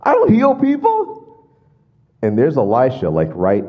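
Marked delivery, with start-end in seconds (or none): 0.0-1.5 high, loud whiny king voice; mocking a king who cannot heal, high and whiny; mocking panicked king. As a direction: play the king as silly and flustered. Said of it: "He's like".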